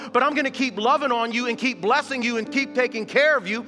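loud, high, ringing preacher's voice; full-force preaching. Acted. Pastor to his congregation on giving, preaching at full force. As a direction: proclaim God's word with rising fire.

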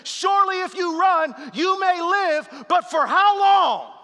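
loud, slow battle cry quote; rally the troops like a movie general; Braveheart speech. Retold or imitated. Pastor quoting a war hero to his church, loud slow rallying cry. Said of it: "Braveheart said it like this".

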